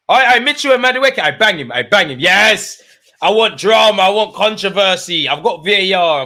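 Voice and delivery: loud, high, hyped-up yelling at the screen; excited shouting. Real, not acted. Fan yelling at the players on screen, hyped-up shouting. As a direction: whip the crowd up, shouting. Watchalong, hyped by on-pitch scuffle.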